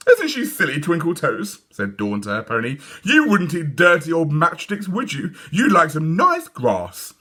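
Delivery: high sugary coo to a toy; high cooing little girl; girl cooing to pony. A narrator's reading. Dawn baby-talks pony.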